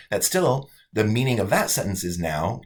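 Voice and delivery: ordinary talk, nothing marked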